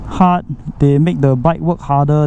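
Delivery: usual speaking voice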